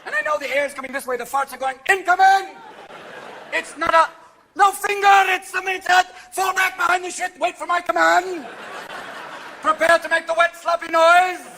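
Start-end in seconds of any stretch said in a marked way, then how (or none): 1.9-11.4 loud, higher battlefield commands; army commander. Acted. Fart soldiers taking orders from commander, shouting. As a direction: bark orders under fire.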